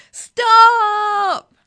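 long, high yell of alarm. A narrator's reading. Passengers on the runaway bus, yelling. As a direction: stretch it into one desperate yell. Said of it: "yelled the passengers".

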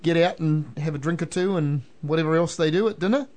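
ordinary talk, nothing marked

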